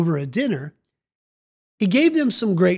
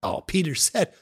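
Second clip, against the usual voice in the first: ordinary delivery, nothing marked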